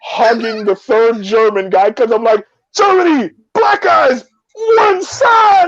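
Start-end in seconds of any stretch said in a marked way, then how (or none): 2.8-5.7 high, excited shout; jubilant calling out. Retold or imitated. Storyteller reliving his cheer to the German guys, jubilant high shout. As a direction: call out in high-pitched glee. Acted celebratory call to the Germans.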